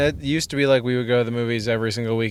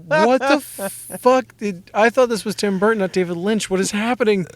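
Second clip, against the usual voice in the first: bewildered outburst; 9 semitones higher than usual. Retold or imitated. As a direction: stretch out the horror of it all. Acting out bewildered reaction to Dumbo.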